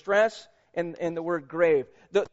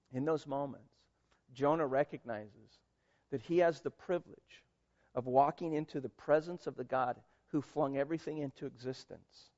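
softer, hushed sermon voice; quiet intimate preaching; 5 semitones lower, 7 dB quieter than usual. Acted. Preacher comforting listeners in hard times, soft and hushed. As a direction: lean in and speak gently to the room.

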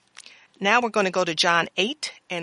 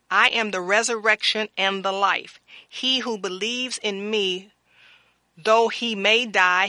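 louder, measured sermon reading of scripture; 2 semitones higher, 4 dB louder than usual. Acted. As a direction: proclaim the verses to the congregation.

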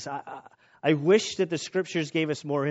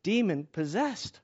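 pleading mother; 3 semitones higher, slower than usual. Retold or imitated. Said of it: "And she says".

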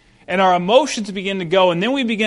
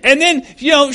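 ordinary talk, nothing marked

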